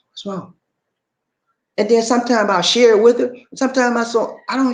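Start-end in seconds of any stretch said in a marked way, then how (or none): 0.1-0.5 soft imitation of a wife checking on him; hushed, retold question; gentle concern. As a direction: ask it quietly, as a caring partner would. Voicing his concerned wife's question.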